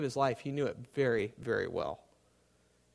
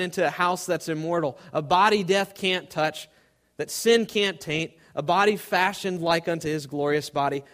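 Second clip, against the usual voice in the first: louder, higher, ringing sermon voice; preaching at full force; 3 semitones higher, 6 dB louder than usual. Retold or imitated. Preacher voices Moody's own triumphant autobiography, full-force preaching. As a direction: proclaim it from the pulpit with conviction. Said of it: "He said this".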